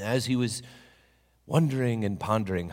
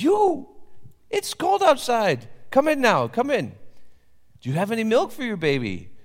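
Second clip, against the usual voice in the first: urgent welcome; 13 semitones higher, 7 dB louder than usual. Acted. Papa urging the mother in from the cold, high and loud. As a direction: hurry her in kindly. Papa urges woman inside.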